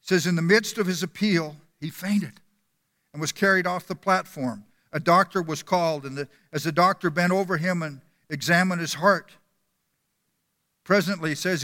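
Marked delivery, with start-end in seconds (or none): none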